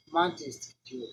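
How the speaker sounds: ordinary delivery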